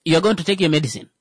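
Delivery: fast, puzzled mock reply; hurried bewildered question; quick baffled retort. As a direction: rattle it off like everyone's confused. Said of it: "everyone will be like".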